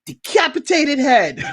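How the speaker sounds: high incredulous laughing cry; laughing exasperated shout. Retold or imitated. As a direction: blurt it out through laughter. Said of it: "And it's like".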